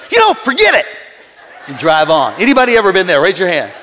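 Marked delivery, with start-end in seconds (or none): 0.1-0.9 high, loud, laughing mock fury; hammed-up fake fury; infuriated. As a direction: pretend to storm off. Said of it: "act infuriated and go".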